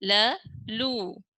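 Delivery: slow spelled-out ल, लू; syllable by syllable. Shown as a demonstration. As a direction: sound out ल then लू slowly. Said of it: "तो इसे पढ़ेंगे".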